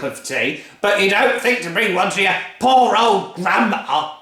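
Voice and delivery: bully the whole family; furious Grandma. Acted. Grandma demanding her breakfast, loud bullying bellow.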